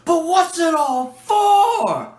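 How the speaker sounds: Doctor demanding the purpose of the machine; impassioned Doctor. Retold or imitated. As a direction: demand the answer in wonder. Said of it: "that speech there".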